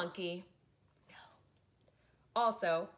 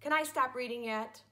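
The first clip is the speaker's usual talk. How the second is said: usual speaking voice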